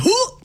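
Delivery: quick high whoop of shock; playful high whoop; mock whoop. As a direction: yelp at the price. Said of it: "I'll be like".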